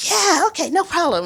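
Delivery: imitated high, chipper group reply; carefree agreement. Everybody answering the guide's warning, carefree agreement. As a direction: agree brightly, pitch up high.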